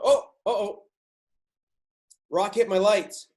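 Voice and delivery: high, loud mock uh-oh; acting out the hitchhiker game with a sudden scare; acted alarm. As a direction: sound the alarm in the driver's voice.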